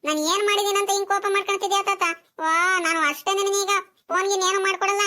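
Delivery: put-on high, sulky child's complaint; hurt protest. Grandson refuses to help grandfather after being scolded, sulky.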